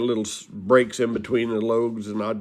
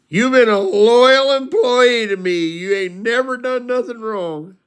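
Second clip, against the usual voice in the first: imitated tearful confession; 9 semitones higher, 5 dB louder than usual. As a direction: wail it like a weepy drunk confessing. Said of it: "And he starts crying".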